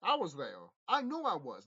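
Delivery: usual speaking voice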